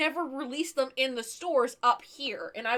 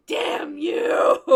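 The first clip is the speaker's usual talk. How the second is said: a loud, breathy mock howl; mock cry of rage; 3 semitones higher, 11 dB louder than usual. Collector retells cursing the rival, hammed-up howl. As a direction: hurl it like a cartoon villain's curse. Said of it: "and I'm like".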